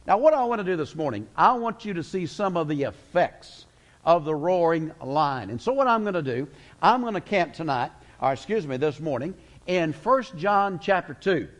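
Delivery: ordinary talk, nothing marked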